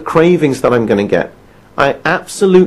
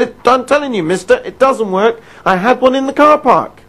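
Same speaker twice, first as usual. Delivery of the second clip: high, insistent complaint; mock angry caller; 8 semitones higher than usual. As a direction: insist he's right, annoyed. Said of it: "He was incredibly angry".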